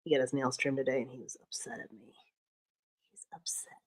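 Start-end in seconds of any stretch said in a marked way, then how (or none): 1.0-3.7 whispered aside about the cat; hushed whisper so the cat won't hear. Real, not acted. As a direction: drop to a secret whisper.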